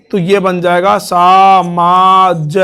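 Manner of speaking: सामाज drawn out slowly with a long आ; vowel lengthened. Shown as a demonstration. Teacher showing students the lengthened vowel, slowly. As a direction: stretch the first vowel of सामाज clearly, slowly. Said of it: "ए के स्थान पर क्या हो जाएगा? आ।".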